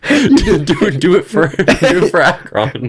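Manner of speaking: high, drawn-out house-elf plea; high pleading Dobby voice; Dobby impression. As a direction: squeal it slowly like Dobby, half laughing. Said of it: "I do a Dobby impression".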